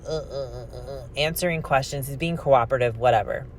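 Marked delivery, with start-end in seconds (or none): 0.1-1.1 soft halting uh uh; quiet mock hesitation; hesitant stammer. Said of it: "Chris is still just like".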